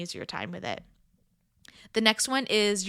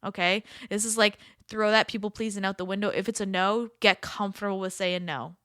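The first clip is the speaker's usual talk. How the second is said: normal talking voice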